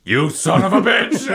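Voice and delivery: furious outburst. Acted. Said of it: "the fury is there".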